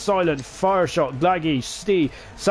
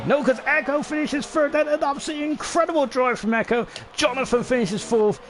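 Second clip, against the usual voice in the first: excited; 9 semitones higher than usual. Real, not acted. Calling a last-corner position swap.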